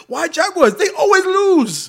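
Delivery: high, loud, imitated Chinese-accented jeer; mock Chinese accent. Restaurant host knocking the fan's team, mock Chinese accent. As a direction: heckle in a hammed-up Chinese accent, stretching the end.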